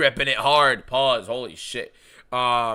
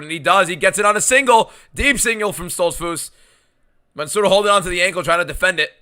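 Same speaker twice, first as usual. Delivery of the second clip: louder, higher urgent call; excited play-by-play; 4 semitones higher, 7 dB louder than usual. Real, not acted. Live fight play-by-play.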